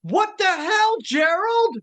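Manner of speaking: high, loud shocked yell; shocked outburst. Acted. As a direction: cry out in disbelief. Referee shocked at betrayal.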